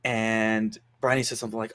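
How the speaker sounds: ordinary talk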